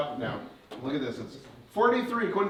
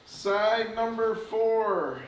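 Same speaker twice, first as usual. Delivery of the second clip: slow, drawn-out counting; slow dramatic countdown; 4 semitones higher, much slower than usual. Acted. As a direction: announce each side slowly. Counting out the reveal.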